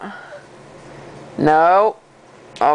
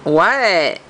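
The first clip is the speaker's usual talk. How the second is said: normal talking voice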